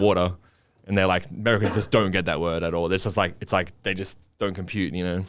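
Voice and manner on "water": "water" in his own Australian accent. Shown as a demonstration. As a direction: say "water" the Australian way, dropping the final r. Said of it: "I would say water".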